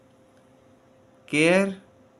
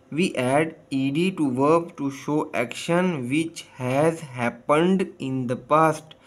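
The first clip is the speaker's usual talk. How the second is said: slow reading; much slower than usual. Shown as a demonstration. Teacher reads English rule slowly.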